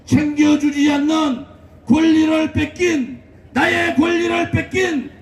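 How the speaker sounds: rally speech. Acted. Fiery rally speech to crowd.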